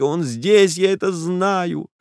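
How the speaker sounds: high, rising toward tears; agitated young woman. Acted. Nastenka's long speech, high pitch.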